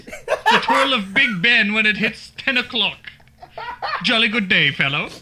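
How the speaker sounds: put-on high, loud hammy British accent; badly faked British, veering into Irish. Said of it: "What about, what about British?".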